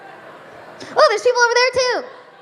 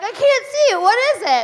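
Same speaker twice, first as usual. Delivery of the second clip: much higher, innocent, clueless; naive high self; 7 semitones higher than usual. Retold or imitated. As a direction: play her younger gullible self. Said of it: "And then I'd be like".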